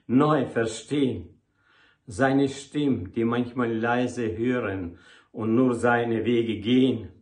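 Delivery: declaimed devotional poem in a steady metre; poem recitation. Acted. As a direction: recite it line by line, pausing after each verse.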